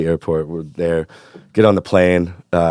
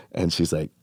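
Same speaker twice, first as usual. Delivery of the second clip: usual speaking voice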